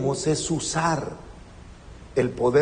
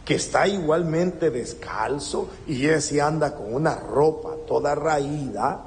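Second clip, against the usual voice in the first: usual speaking voice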